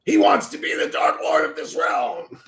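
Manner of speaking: high, shouted mock proclamation; booming proclamation. Streamer hamming up exposing the Dark Lord, shouted high. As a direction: announce it like a herald to the realm.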